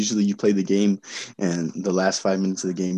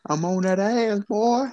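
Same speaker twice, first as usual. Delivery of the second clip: high taunting; 12 semitones higher, slower than usual. Real, not acted. Taunting rival in pick'ems.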